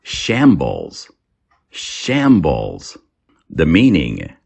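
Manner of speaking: usual speaking voice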